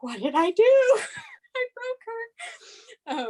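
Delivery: high-pitched comic wail imitating a friend; play-acted high panic; mock panic. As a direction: squeal it in pretend dismay, laughing. Friend panics after girl cries.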